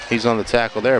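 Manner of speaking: normal talking voice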